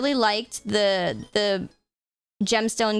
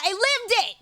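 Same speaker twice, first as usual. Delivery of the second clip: proud exclaim; 11 semitones higher than usual. Real, not acted. Proud of childhood event Pokemon.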